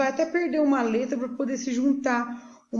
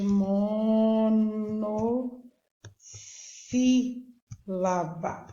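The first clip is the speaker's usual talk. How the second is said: "monossílaba" hugely stretched, with long held syllables; much slower than usual. Shown as a demonstration. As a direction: draw "monossílaba" out very slowly, part by part. Teacher stretching the new term.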